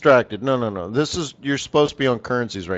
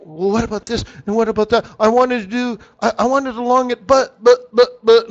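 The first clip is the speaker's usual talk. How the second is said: whiny dithering trader; 8 semitones higher than usual. Retold or imitated. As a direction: imitate a flustered trader tripping over buts. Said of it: "some people say".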